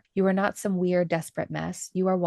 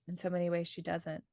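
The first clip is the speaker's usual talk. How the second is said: soft, much softer echo of the point; hushed echo; 8 dB quieter than usual. Real, not acted. Echoing her co-host's point about Carmen, hushed and soft. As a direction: let it sink in quietly.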